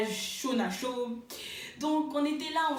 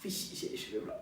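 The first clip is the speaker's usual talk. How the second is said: a whispered laugh of pride; breathy giggling boast; proud, laughing; 7 semitones lower, 8 dB quieter than usual. Retold or imitated. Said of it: "toute fière de moi".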